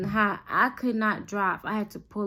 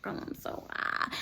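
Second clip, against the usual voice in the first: barely voiced, acted confession; hushed confession; 13 dB quieter than usual. Confessing to best friend she is high, hushed. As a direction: nearly whisper it, imitating a woozy admission.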